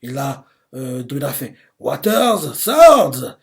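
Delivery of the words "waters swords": Waters rises to a loud, high shout on swords; shouted attack name. Retold or imitated. Mash's foe shouting his water attack, loud yell. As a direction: call out the attack like an anime hero. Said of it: "Il crie ça".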